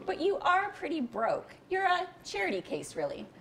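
high, mocking condescension; smug. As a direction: talk down to him, amused. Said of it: "Erica was smug and condescending".

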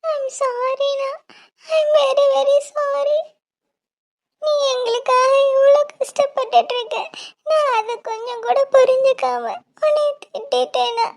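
sobbing, pleading for forgiveness; tearful sister begging her brother's pardon; crying apology. Acted. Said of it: "எதுக்குடா அழுகுற?".

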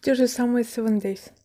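speaking between bites; chewing while talking. Real, not acted. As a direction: mouth partly full of pastry. Seen 0: Chewing pastry bites before and after; lips pressed mid-chew at 0.3.

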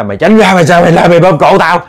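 high, loud frantic yell; man fighting an invisible ghost, yelling high and loud; possessed man yelling. Retold or imitated. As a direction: shout them away in panic. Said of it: "Ông la vậy quá trời".

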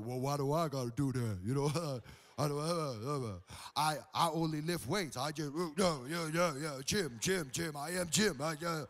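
low, dim muscleman voice; big gym bro. Retold or imitated. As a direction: mumble and stumble like a meathead lifter. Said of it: "this is how they talk".

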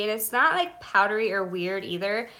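ordinary delivery

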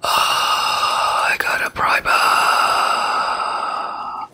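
breathless excited whisper. Real, not acted. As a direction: whisper it with glee.

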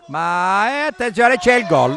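high, loud goal shout; excited goal call. Real, not acted. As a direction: yell it as the goal goes in. Goal scored during live commentary.

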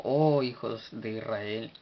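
long, swelling "Oh" before the rest; slow exclamation, weight on "Oh"; emphatic exclamation. Shown as a demonstration. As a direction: stretch "Oh" slowly as a solemn call. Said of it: "de una énfasis como".

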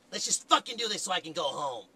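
normal talking voice

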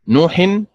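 نوح stressed on the نون, said high and strong; stress on the nun. Shown as a demonstration. Teacher demonstrating نوح, stress on the نون. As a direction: hit the نون of نوح firmly. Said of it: "النبر فيها على حركة النون فتنطق هكذا".

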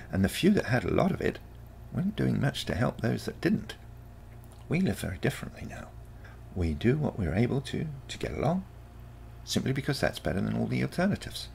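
put-on breezy, patient teenage lecture to her grandparent; teenage granddaughter. A narrator's reading. Marina explains her future world.

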